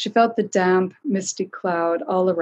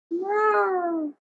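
plaintive meow; 13 semitones higher than usual. A narrator's reading. Cat calling from behind the trio, plaintive meow. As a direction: mew like a lost little cat. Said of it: "came a plaintive cry".

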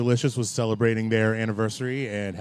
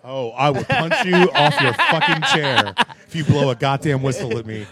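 laughing threat; 2 semitones higher, 4 dB louder than usual. Real, not acted.

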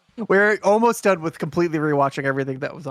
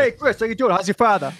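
put-on Boston accent, higher; 7 semitones higher than usual. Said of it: "It was very much that".

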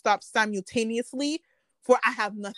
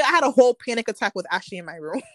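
ordinary talk

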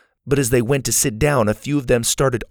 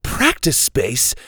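high, loud annoyed comeback; annoyed retort; 13 semitones higher, 3 dB louder than usual. A narrator's reading. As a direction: argue about space. Achara argues back.

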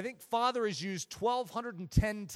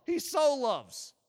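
"so" punched higher, louder and stretched; stress on SO; 4 semitones higher, 5 dB louder, much slower than usual. Shown as a demonstration. Preacher insisting it isn't just love, emphatic stress on SO. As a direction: hammer a long, raised "so". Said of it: "you notice I emphasized so".

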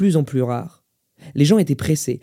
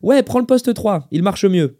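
high, slow, raised reply; pitched-up shopkeeper's call; calling instructions; 6 semitones higher, 4 dB louder than usual. Acted. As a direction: direct a customer across the shop. Manager directs customer across shop.

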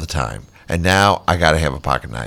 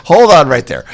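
surprised; 9 semitones higher, 8 dB louder than usual. Real, not acted. Stopped by surprising word.

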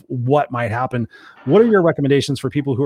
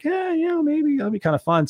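high mock agreement; 13 semitones higher than usual. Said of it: "And I was like".

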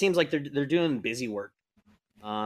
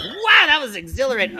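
giddy crewman; 11 semitones higher, 5 dB louder than usual. Acted. Crewman after slapping.

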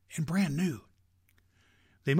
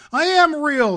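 much higher and louder cry; desperate protest; 13 semitones higher, 10 dB louder than usual. A narrator's reading. Little rabbit protesting to the wild rabbit, high and loud. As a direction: insist near tears. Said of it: "said the little rabbit".

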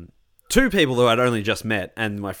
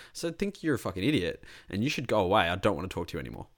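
normal talking voice

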